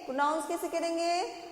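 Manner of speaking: usual speaking voice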